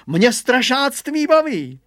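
high, slow, loud show-off; boasting. Acted. Boy boasting to the family, slow high brag. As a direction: brag proudly, stretching it out. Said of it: "naparoval se Hugo".

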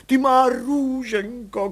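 very slow, high tearful cry. A narrator's reading. Mother weeping over her recovered baby, high tearful cry. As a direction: wail over the found baby. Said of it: "křičela v pláči".

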